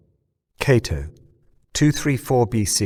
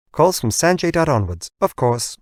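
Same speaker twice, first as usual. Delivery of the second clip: higher bright confirmation; assistant voice; 6 semitones higher than usual. Acted. Hologram assistant confirms the playback, higher. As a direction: confirm like a cheerful device.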